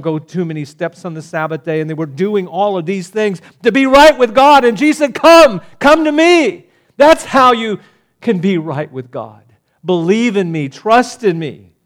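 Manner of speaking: loud, high-pitched pulpit proclamation; fiery sermon reading of Isaiah; impassioned preaching. Acted. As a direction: cry the invitation out to the crowd. Preaching climax.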